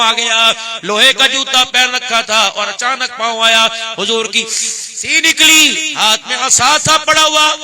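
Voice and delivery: loud, high, impassioned sermon voice; fiery preaching. Acted. Preacher rousing the congregation with a battle story, loud and impassioned. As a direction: preach it out to the whole crowd.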